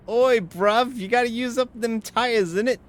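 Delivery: put-on high, loud cockney British accent; hammed-up British geezer ordering him around; aggressively British Reska. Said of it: "my Reska impression".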